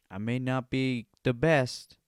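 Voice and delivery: normal talking voice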